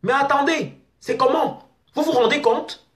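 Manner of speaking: outraged, voice climbing high. Real, not acted. Outraged rant at fake profiles. (indignation aiguë, voix très haute)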